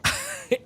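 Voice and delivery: short high laugh; amused laugh. Real, not acted. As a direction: burst out in a high, delighted laugh. Amused by the movie script.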